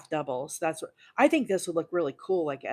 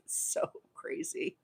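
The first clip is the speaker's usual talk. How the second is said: laughing; 12 semitones higher, 6 dB quieter than usual. Real, not acted. Laughing at herself.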